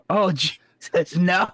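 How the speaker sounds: high, drawn-out cry of horror; comic dismayed realization; mock dismay. Retelling own dismayed realization about age.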